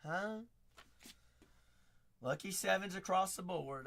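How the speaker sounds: ordinary delivery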